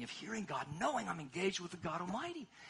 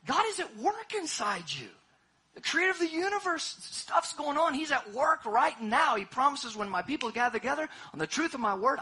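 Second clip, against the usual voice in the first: loud, rising sermon climax; full-force preaching; 5 semitones higher, 7 dB louder than usual. Acted. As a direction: preach it out, big and ringing.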